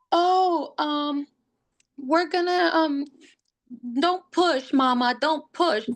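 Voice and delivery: high, flustered "don't push, mama"; flustered nurse. Retold or imitated. Nurse flustered telling her wait.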